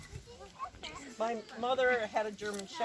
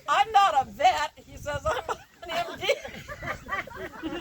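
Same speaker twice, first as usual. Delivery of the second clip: laughing retelling; 6 semitones higher, 5 dB louder, much slower than usual. Retold or imitated. Offended doctor retold, laughing.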